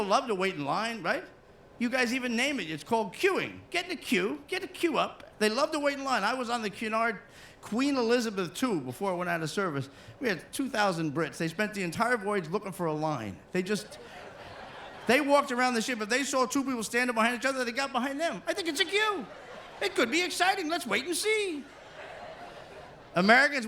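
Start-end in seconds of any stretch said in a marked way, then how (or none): 3.7-5.2 put-on English accent. Imitating English people. 18.5-21.6 put-on excited English accent. Imitating excited Brit in line.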